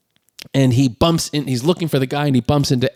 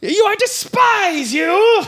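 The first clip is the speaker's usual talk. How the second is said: very high, drawn-out theatrical shout; mock furious shout; much slower than usual. D'Artagnan shouting at his enemy from the window, mock fury. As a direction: hurl it out slowly, hammed-up and high.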